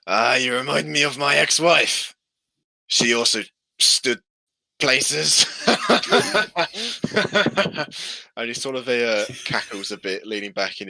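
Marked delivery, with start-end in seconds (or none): 0.1-5.4 put-on drunk old man. 5.5-8.3 high, loud wheezing cackle; drunken cackle. Acted. Drunk old man cackles at his own joke, high and loud.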